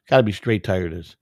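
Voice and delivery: ordinary delivery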